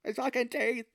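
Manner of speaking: put-on a struggling actor fighting his Irish accent; lispy mock Irish accent through bad teeth. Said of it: "supposed to have an Irish accent".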